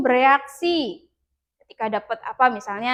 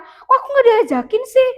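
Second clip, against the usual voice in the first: much higher, louder whiny complaint; mock angry outburst; 12 semitones higher, 6 dB louder than usual. Hurt pupil left out by friends, mock angry whine. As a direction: act out the kid who snaps when left out. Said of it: "jangan langsung marah".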